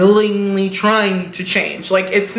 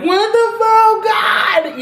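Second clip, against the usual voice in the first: long drawn-out high shout of disbelief; stunned shout; 11 semitones higher, much slower than usual. Retold or imitated. Archie and Jughead stunned by the news, drawn-out high shout. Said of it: "standing there like".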